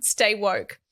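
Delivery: normal talking voice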